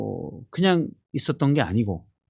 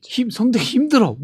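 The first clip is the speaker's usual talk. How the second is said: higher, louder strained whine; strained complaint; 10 semitones higher, 4 dB louder than usual. Retold or imitated. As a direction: squeeze it out like sore vocal cords. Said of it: "방송 끝나면서 얘기하더라고요".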